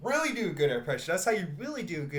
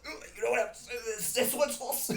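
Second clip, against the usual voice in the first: much higher, burpy put-on voice; drunken cartoon impression; 10 semitones higher than usual. Imagining ordering sauce at McDonald's in drunken Rick voice. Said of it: "I shoulda did it like that".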